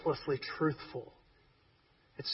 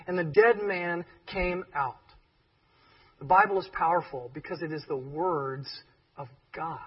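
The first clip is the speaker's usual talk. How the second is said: ordinary talk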